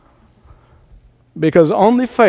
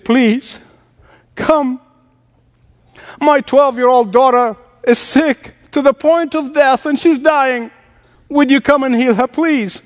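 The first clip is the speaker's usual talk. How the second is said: a father pleading for his sick daughter, pitched up; begging father; 7 semitones higher than usual. Retold or imitated. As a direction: plead with Jesus, urgent and pitched up. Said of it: "Bible said he was begging Jesus".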